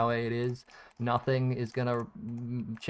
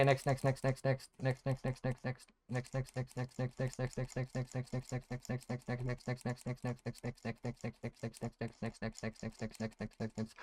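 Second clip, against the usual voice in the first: quiet rapid muttering; 12 dB quieter than usual. Real, not acted. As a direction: murmur next next next under your breath, much softer. Rapidly skipping through items.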